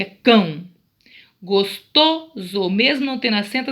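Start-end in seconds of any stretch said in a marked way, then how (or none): 0.2-0.6 "cão" said alone, strong and drawn out; louder, isolated "cão" for the class; stressed syllable shown. Shown as a demonstration. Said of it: "Qual que é a sílaba tônica?". 1.4-2.7 "Gostoso" very slowly by syllable, "to" strongest; slow teacher demo of "Gostoso", middle syllable stressed. Shown as a demonstration. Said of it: "a sílaba mais forte é to".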